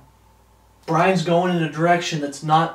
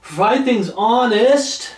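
honest drawn out higher, louder and slower with a rise; stress on honest; 5 semitones higher, 5 dB louder, slower than usual. Shown as a demonstration. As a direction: lean hard on honest and lift it. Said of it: "I have to emphasize that word".

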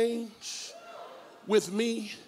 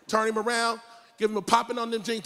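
ordinary talk, nothing marked

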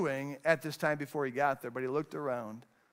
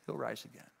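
hushed promise; 6 semitones lower, 12 dB quieter, faster than usual. Acted. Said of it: "He wasn't smirking when he said".

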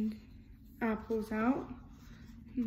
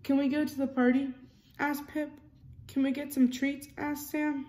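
lively storybook reading, character lines a bit louder; reading to children; 3 semitones higher, 4 dB louder than usual. A narrator's reading. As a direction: read it like a bedtime picture book. Reading picture book aloud.

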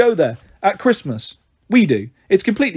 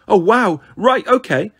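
put-on higher, eager little-boy voice; excited child; 4 semitones higher, 3 dB louder than usual. Little boy promised a story by his sister, thrilled. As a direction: jump at the offer eagerly.

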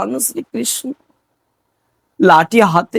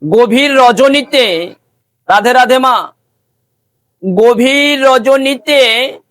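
raised, sustained devotional storytelling; preaching; 5 semitones higher than usual. Acted. Preacher telling devotees of Raghunath in Vrindavan, intoned preaching. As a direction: preach it out in long intoned phrases.

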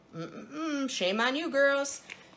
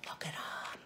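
breathy awed whisper; voiceless, hushed admiration; 6 semitones lower, 21 dB quieter than usual. Real, not acted. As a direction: whisper it like a treasure reveal. Hushed showing off earrings.

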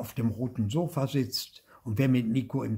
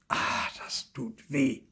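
pained ouch; 3 semitones higher, slower than usual. A narrator's reading. Head hit on the garden house.

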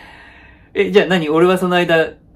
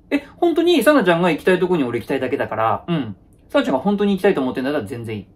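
usual speaking voice

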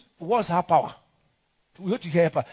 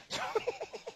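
soft laugh; 13 dB quieter than usual. Real, not acted. Laughs after joke.

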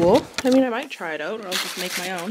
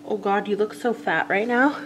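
normal talking voice

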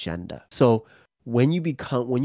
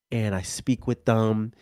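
ordinary talk

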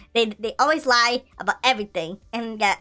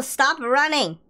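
ordinary talk

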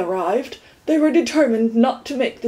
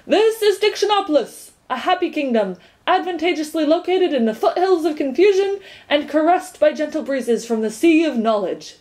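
higher, announcing like an official herald; pompous gateman; 5 semitones higher than usual. Acted. Gateman greeting Milo at the gate, pompous announcement. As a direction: proclaim the welcome grandly.

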